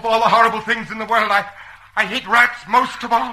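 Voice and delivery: high, tense panicky voice; frightened. Acted. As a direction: phobic panic rising through the line. Terrified of rats.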